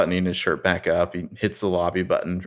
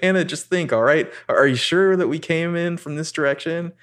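exasperated Ari; 9 semitones higher than usual. Retold or imitated. Ari snapping at Hannah about the trees, exasperated high voice. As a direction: spell out the obvious, fed up.

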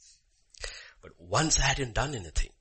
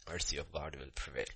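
low, much softer, solemn restatement; hushed repeat; 5 semitones lower, 10 dB quieter than usual. Real, not acted. Preacher repeats the truth to congregation, hushed. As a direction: let it sink in quietly. Same line repeated softly.

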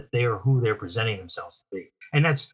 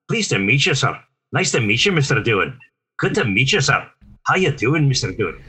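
higher, lilting Scottish brogue; Scotty's Scottish accent; 3 semitones higher, 3 dB louder than usual. Acted. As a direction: practise a Scottish Scotty greeting out loud. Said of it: "that his voice, you know".

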